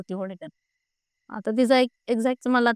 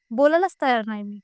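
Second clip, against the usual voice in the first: ordinary talk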